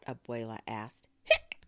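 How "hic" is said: sharp loud "hic"; abrupt hiccup burst. Acted. Boy interrupting grandmother's question, sharp hiccup.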